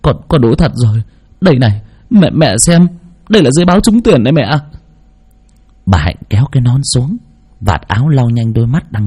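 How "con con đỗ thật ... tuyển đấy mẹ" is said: lightly voiced son, rising and speeding up; eager, a touch choked up; tearful son. A narrator's reading. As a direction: son showing his mother the admission letter, mildly tearful and eager. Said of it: "Thằng Phát cũng rưng rưng nước mắt".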